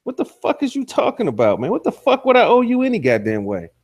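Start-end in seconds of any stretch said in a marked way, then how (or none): none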